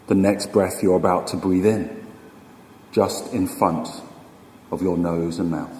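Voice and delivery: slow, unhurried meditation guide with long pauses; guided meditation. Acted. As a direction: ease them into stillness, phrase by phrase, leaving room to breathe.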